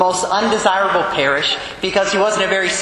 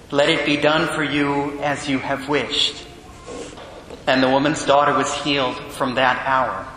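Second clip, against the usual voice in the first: lower, measured scripture reading; Gospel reading; 5 semitones lower, 4 dB quieter than usual. Acted. Priest proclaiming the Gospel to the congregation, solemn reading.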